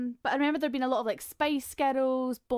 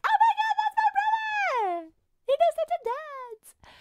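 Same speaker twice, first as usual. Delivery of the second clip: much higher, louder excited squeal; excited kid; 6 dB louder, much faster than usual. Retold or imitated. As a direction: gush like a proud little sister. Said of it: "and me being like".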